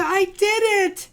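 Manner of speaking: high, loud triumphant chant; bursting with excitement; child shouts to mom she lost her tooth, high and loud; gleeful shouting. Acted. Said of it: "screamed Elda".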